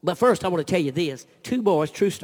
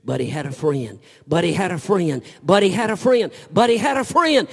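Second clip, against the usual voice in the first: building repetition; 5 dB louder than usual. Acted. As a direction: climb louder with every repeat. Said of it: "repeated line rising each time".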